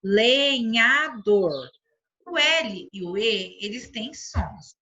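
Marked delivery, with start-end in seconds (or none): 0.0-1.7 LENHADOR drawn out as le, nha, dor; teacher stretching each syllable of "lenhador". Shown as a demonstration. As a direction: say "lenhador" very slowly, one syllable at a time. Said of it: "Por exemplo, nessa primeira palavra".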